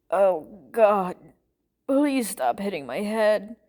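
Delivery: groggy, slow plea; pained, drawn-out pleading. A narrator's reading. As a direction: beg them to stop, dragging the words. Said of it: "Poke pleaded".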